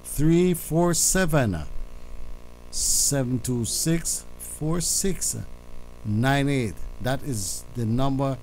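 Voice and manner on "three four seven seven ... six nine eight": slow, each digit spaced apart; slow and deliberate. Shown as a demonstration. Host repeating the phone number slowly so listeners can write it. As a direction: spell out the number slowly for callers. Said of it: "let me go a little slower".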